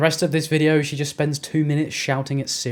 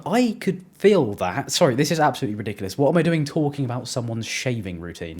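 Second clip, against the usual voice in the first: normal talking voice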